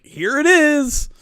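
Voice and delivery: high, loud, drawn-out cry; exasperated call-out. Retold or imitated. Traveler shows lost and found the screenshot, exasperated call-out. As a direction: stretch it out loudly, way up high.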